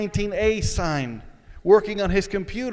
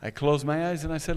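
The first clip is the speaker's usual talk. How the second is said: usual speaking voice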